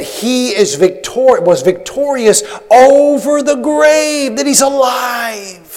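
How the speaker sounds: high, slow, drawn-out preaching cry; soaring pulpit climax; triumphant proclamation. Real, not acted. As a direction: proclaim it in triumph, stretching the words.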